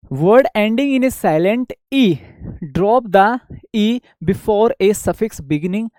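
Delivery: each English word drawn out slowly for copying; slow dictation. Shown as a demonstration. Teacher reading a grammar rule aloud to students, slow dictation. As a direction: read the rule slowly so students can write it down.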